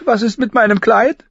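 put-on high, loud girl's demand; indignant girl. Sansa challenges her sister about the dress, indignant.

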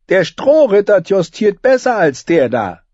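high, loud mocking king; sneering young king. A narrator's reading. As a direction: sneer it like a cruel boy king. Joffrey sneers at jousting knight.

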